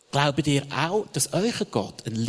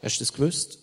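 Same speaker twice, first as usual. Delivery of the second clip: normal talking voice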